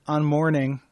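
slow dramatic poetry recital; poem reading. Acted. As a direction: read it like a solemn poet.

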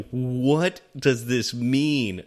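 slow, drawn-out bewildered question; baffled Zach. Retold or imitated. Host retelling co-host's confusion over a review, slowly baffled. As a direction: stretch every word in puzzled disbelief. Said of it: "Zach was just like".

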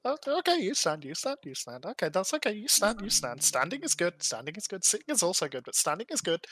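put-on high, fussy old woman reassuring; old tabaxi voice. Old tabaxi telling the wary player standing is fine, high and fussy. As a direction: soothe them like a flustered old crone.

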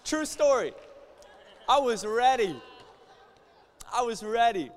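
loud, high preacher cry; preaching shout. Acted. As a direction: call it out to the whole church.

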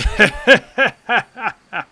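loud, high burst of laughter; big laugh. Acted. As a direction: roar with laughter at your own song. Laughs after bawdy song.

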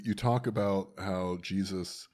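ordinary delivery

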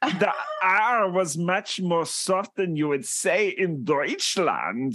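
mock German accent. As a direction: lecture in a put-on German accent. Explains in put-on German accent.

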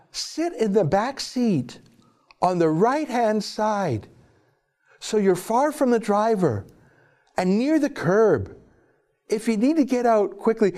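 high-pitched parody of a safety advisor; mocking, sing-song official helpfulness; mock police advice. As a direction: read out tips in a put-on high voice. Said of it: "Police have offered these safety tips".

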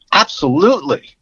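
imitating her unreserved agreement; emphatic retold assent. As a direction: say it as a ready, wholehearted yes. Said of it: "when she said it like that".

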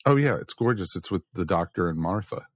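ordinary talk, nothing marked

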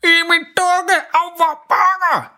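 high, slow, mangled words through a burnt tongue; burnt-tongue talk. A narrator's reading. Dad with burnt tongue telling kids, high garbled pain. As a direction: talk as if the tongue won't work. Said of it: "siger far og peger på sin mund".

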